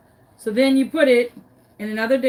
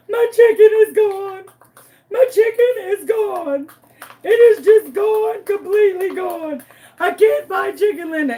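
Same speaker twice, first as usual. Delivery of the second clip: hammed-up crying; 9 semitones higher, 6 dB louder than usual. Calling Kat about the missing chicken, hammed-up crying. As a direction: bawl it out in mock despair. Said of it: "I am crying my eyes out, crying".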